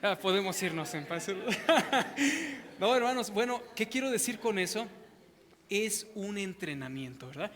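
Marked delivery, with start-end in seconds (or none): 1.3-2.7 high laugh. Real, not acted. Pastor laughing after joking everyone can leave, high.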